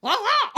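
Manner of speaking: high, loud squawking "Ah, ah!"; raven calls. Retold or imitated. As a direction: squawk back and forth with the bird. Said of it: "the way I'm like".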